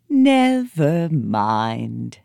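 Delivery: slow, kindly elephant. Acted. Old elephant soothing the girl, slow. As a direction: let it rumble out slowly. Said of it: "said Hati".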